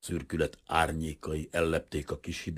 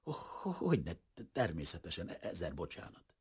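embarrassed stammer, softer and softer; 6 dB quieter than usual. Acted. Blushing shopkeeper stammering apologies to the priest. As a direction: stumble over each first sound, flustered. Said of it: "elvörösödve kezdett dadogni".

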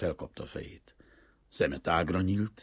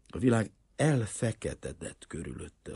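usual speaking voice

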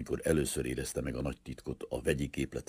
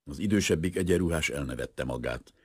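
usual speaking voice

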